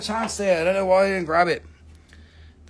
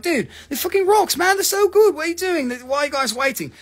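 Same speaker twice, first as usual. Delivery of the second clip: mock excited viewer; 10 semitones higher than usual. Mocking a viewer hyping the rocks, high and gushing. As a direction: imitate a hyped buyer urging the others. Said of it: "Some of you are like".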